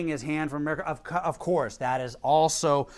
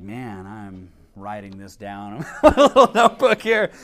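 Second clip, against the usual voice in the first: laughing; 9 semitones lower, 8 dB louder than usual. Retold or imitated. Laughing while retelling own quote.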